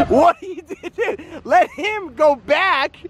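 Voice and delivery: high, laughing, pleading at the driver; excited laughing shout. Real, not acted. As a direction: shout instructions through laughter.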